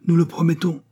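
high, emphatic pledge; cried in unison. A narrator's reading. Electors promising to defend Foulon, calling out. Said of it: "crièrent les électeurs tout d'une voix".

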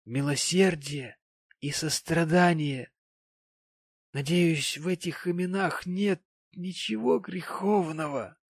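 high-voiced sentimental gentleman; maudlin tipsy gentleman. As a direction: play lightly pious, maudlin emotion.

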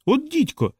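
високий вигук без крику; вигукни лайку високим голосом. A narrator's reading. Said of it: "вигукнув Пітер". (здивований високий вигук)

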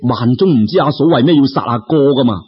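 ordinary delivery, nothing marked

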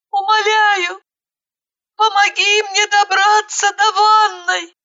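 thin, high begging; trembling plea. A narrator's reading. Injured girl begging for help to the bathroom, pleading. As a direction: implore weakly in a high voice. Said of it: "дрожащим голосом попросила она".

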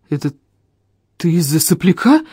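halting, disbelieving question; taken aback. A narrator's reading. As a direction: stumble into mild astonishment. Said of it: "опешил Эльм".

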